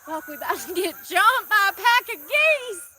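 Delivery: sudden loud panic at geese; alarmed. Real, not acted. Geese approaching.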